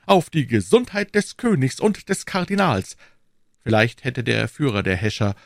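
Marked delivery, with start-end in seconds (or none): 0.0-3.0 high, ringing toast; feigned enthusiasm. A narrator's reading. D'Artagnan toasting King and Cardinal, carried away by enthusiasm. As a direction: propose it as if swept away. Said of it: "als wäre er von Begeisterung hingerissen".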